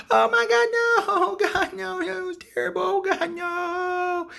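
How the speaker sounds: very high, loud wailing panic; stretched-out, hammed-up shriek; mock horror. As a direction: wail like a terrified little guy. Little guy horrified at the stabbing.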